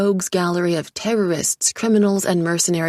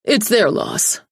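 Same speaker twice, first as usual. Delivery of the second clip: dismissive; 4 semitones higher, 5 dB louder, slower than usual. A narrator's reading. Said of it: "he snapped with a dismissive flick".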